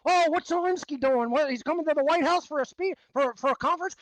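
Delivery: mocking influencer chatter. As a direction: send up a breathless online influencer. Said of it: "This is what you hear every day".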